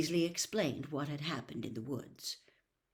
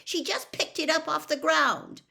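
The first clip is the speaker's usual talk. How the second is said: much higher, louder shout; 11 semitones higher, 8 dB louder than usual. A narrator's reading. Hermione shouting at Percy in defence, shouting. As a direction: shout him down. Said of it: "shouted Hermione".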